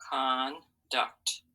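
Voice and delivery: "conduct" as "con", pause, "duct"; split into syllables. Shown as a demonstration. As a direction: break "conduct" in two, full vowel in "con". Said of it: "we have our two lines".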